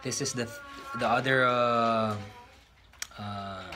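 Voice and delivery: ordinary talk, nothing marked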